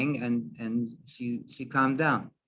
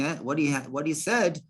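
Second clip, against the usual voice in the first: ordinary talk, nothing marked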